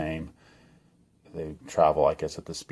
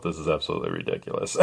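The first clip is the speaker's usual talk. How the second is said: laughing; 3 semitones lower, 4 dB quieter, faster than usual. Retold or imitated. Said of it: "thinking to herself".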